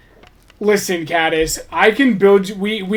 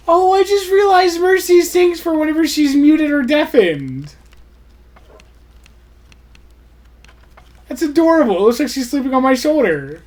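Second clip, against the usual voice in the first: high fond coo, louder; 9 semitones higher, 3 dB louder than usual. Real, not acted. Cooing at the pet sleeping on her shoulder. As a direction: gush over how adorable it is.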